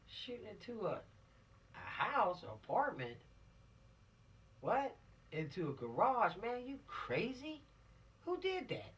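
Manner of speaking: acting appalled at the news; incredulous. Teacher acting appalled at students' shooting story, incredulous.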